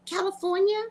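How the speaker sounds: high, disbelieving retold cry; mock outrage. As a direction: act stunned at the bill. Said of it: "I was like".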